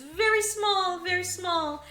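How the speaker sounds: high, squeezed little voice; minimizing voice. Retold or imitated. Editor voiced, minimizing the prices.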